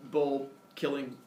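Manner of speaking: ordinary delivery, nothing marked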